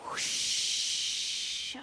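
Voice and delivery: long breathy whoosh of wind; whispered wind whoosh. Shown as a demonstration. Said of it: "Can you make a wind sound with me?".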